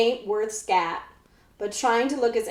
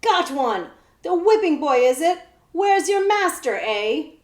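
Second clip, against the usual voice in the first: gleeful outlaw; 6 semitones higher, 5 dB louder than usual. A narrator's reading. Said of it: "Cutwater's muffled cackle".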